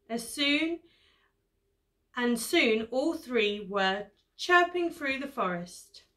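usual speaking voice

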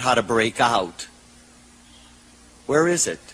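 low, subdued voice with pauses; imitated lonely caller. Lonely caller phoning a talk show about love, low and subdued.

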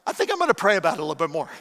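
fast, laughing through the words. Retold or imitated. Pastor telling the doctor he'd rather pray, fast and laughing. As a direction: toss it off with a laugh.

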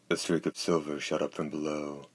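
plain reading voice

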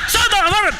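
high, mocking repeat of the order; echoed command. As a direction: parrot the sergeant's yell.

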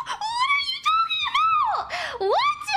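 very high, incredulous screech; yelled in disbelief. Real, not acted. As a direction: shriek it out, stunned. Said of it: "I'm sorry I yelled".

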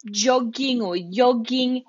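"jogging or yogging" said slowly, the J turning into Y; Italian pronunciation. Shown as a demonstration. Teacher demonstrating Italian "jogging" slowly to students. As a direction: stretch out "yogging" with a Y, Italian style. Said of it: "depending on who's saying it".

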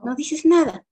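high, loud teacher demand; stern teacher. Retold or imitated. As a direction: call out the silent pupil sharply. Teacher calls out quiet pupil.

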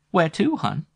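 high, flirty question; sexy voice. Acted. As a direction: tease it lightly. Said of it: "Flo's sexy voice".

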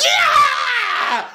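high, drawn-out mock scream; imagined outburst. Imagining breaking down at the altar, high mock scream. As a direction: scream it out like a wedding meltdown. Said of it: "I'd be like".

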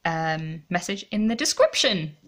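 ordinary talk, nothing marked

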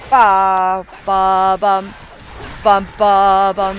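loud hummed fanfare for a hit; mock fanfare. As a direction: sing the victory jingle as you strike. Sound effects for attacks.

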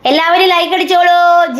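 high sing-song call to viewers. Real, not acted. Calls viewers to like the live. (ഉയർന്ന സ്വരത്തിൽ വിളിച്ചുപറയുന്നു)

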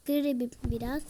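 ordinary talk, nothing marked

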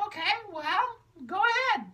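very high, gentle puppet reassurance; caring high puppet. Acted. Charcoal reassures sad Magenta, very high and kind. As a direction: comfort her as a sweet little puppet.

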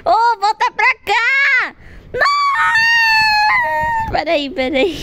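panicked shouting while fleeing; being chased. Real, not acted. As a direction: yell in real panic.

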